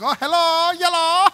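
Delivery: high, loud mom greeting; hammed-up cheery hello. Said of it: "his mom's like".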